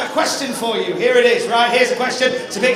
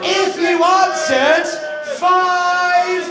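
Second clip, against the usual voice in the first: hyped presenter; 4 semitones higher than usual. Acted. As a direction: announce answers with showmanship. Presenter quiz reveal.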